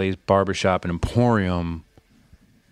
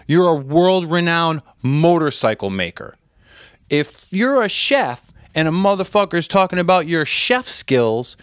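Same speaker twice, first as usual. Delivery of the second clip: high-pitched, raised re-enacted rant; emphatic retold lecture; 7 semitones higher, 4 dB louder than usual. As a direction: hammer the point home, drawing out the verdict. Re-enacts emphatic speech made to someone.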